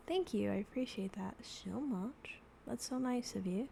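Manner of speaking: normal talking voice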